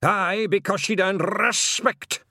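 loud, high, drawn-out Scots cry; ringing Scottish retort. A narrator's reading. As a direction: let it ring round the stones, slowly. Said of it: "voice seemed to echo around the stones".

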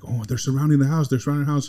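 high, panicked warning; urgent, frightened cry to grandma; alarmed. Retold or imitated. Aunt panics at gunmen outside.

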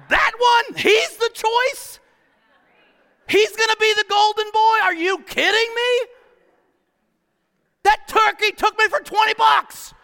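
high, loud mock outrage; outraged townsfolk. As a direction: ham up the scandalised neighbour. Community voiced in outrage.

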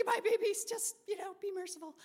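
high, hushed plea for mercy; pleading. Retold or imitated. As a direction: beg softly for mercy.

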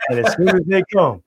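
ordinary talk, nothing marked

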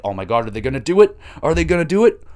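loud, rising hopeful questions; excited anticipation. Retold or imitated. Fan reliving the Acclaimed coming out, excited anticipation. Retelling his hopeful reaction to a rescue.

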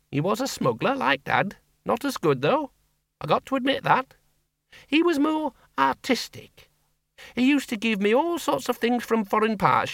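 put-on a blunt old farm woman talking plainly; Miss Flitworth. A narrator's reading.

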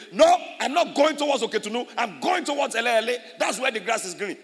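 loud, high indignant cow voice; mock cow's protest. Preacher voicing a stubborn cow, high and loud.

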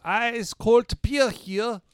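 slow, high vendor call; stadium vendor hawking. Retold or imitated. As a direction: hawk beer like a ballpark vendor. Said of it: "it was an ice cold beer. I said".

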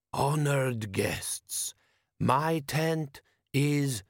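put-on slow, accented, ceremonious elder voice; desert wise man. A narrator's reading. Wise man answers his foreign guest, slow and stately. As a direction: intone it slowly as a foreign sage. Host corrects guest, ritual welcome.

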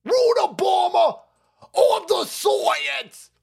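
high, slow, strained mock shout of a boastful old doctor; Fauci impression. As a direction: ham it up as a preening old scientist. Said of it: "I can't do the fucking voice anymore".

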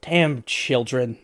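fed-up hermit swearing, stretched slowly; angry curse. Acted. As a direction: curse after the fleeing kids. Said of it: "all you hear is".

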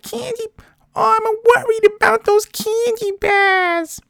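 put-on extremely high, slow, squealing New Hampshire accent; ridiculous New Hampshire accent. Worried woman in line at the drugstore, ridiculous New Hampshire accent. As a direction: wail it out in a cartoonish mock New Hampshire accent. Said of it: "a ridiculous New England, New Hampshire accent".

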